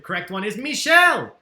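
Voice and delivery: loud, bright announcing of the answer; excited reveal. Real, not acted. Host reveals the right answer to players, excited. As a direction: call out the winner's name with a burst.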